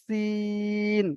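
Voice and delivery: সিন with a thin whistling s and a long vowel; sharp s sin. Shown as a demonstration. Teacher contrasting letters, drawn-out সিন. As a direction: hiss সিন then hold it. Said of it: "সিঁড়ির মতো".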